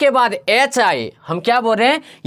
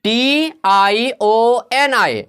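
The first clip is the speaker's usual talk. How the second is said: slow spelling; much slower than usual. Shown as a demonstration. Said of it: "टीआईओएन आ जाता है".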